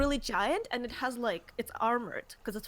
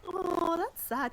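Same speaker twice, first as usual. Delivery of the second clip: normal talking voice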